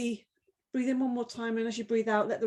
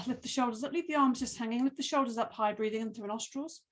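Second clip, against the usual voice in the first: ordinary talk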